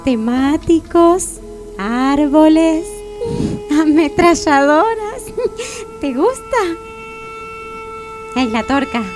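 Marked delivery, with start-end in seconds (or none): 2.9-3.7 short, high, soft giggle; risita coqueta. Acted. Coquetea en la cena romántica. 5.3-6.0 little high-pitched soft giggle; risita coqueta. Acted. Coquetea en la cena romántica.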